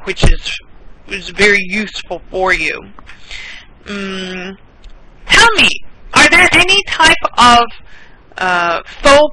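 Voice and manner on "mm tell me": normal talking voice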